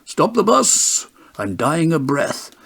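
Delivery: high, slow, drawn-out plea; crying out. A narrator's reading. Mister Weasley crying to the driver to stop at a pub, high and slow. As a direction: wail for the bus to stop. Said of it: "cough like a billy goat and cry".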